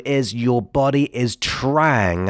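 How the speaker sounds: "trying" drawn out slowly and leaned on; slow down and lean hard into "trying"; stress on TRYING. Shown as a demonstration. Coach stressing a key word to listeners: "trying" slow and weighted. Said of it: "and I'm emphasizing the word trying".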